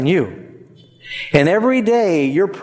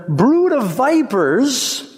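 higher, slow rebuke, "Brood" thrown out in a rising cry; fiery prophet; 7 semitones higher, slower than usual. Retold or imitated. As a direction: denounce them like the Baptist, dragging out "Brood of vipers". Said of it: "He said to them".

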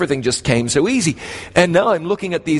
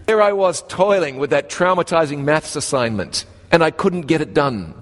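ordinary talk, nothing marked

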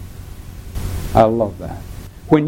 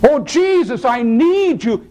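higher, urgent plea; pleading; 9 semitones higher than usual. Acted. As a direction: beg for help. Pleading voiced believer.

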